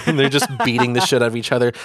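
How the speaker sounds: ordinary talk, nothing marked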